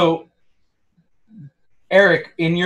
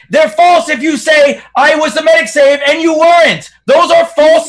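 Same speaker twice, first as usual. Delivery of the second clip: angry shout; 6 semitones higher, 4 dB louder than usual. Real, not acted. Heated argument, shouted.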